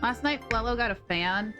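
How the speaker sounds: normal talking voice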